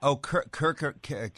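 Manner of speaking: ordinary talk, nothing marked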